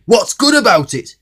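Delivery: loud, high indignant grumble; grumpy retort. Acted. Mister Grumpy snaps at Mister Happy, loud and cross. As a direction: snap it back in disgust. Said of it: "said Mister Grumpy".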